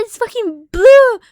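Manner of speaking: high, loud and slow; insistent yell. Retold or imitated. Insisting to mom the bug is blue, high loud yell. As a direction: shout that it really is blue. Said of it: "And I said".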